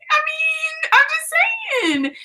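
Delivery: squeaky drawn-out protest; high defensive whine. Real, not acted.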